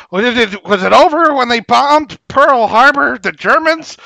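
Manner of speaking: loud, high-pitched indignant movie-speech parody; mock rousing outburst. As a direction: belt it out like a hammed-up rallying speech. Mock rallying speech, quoted film line.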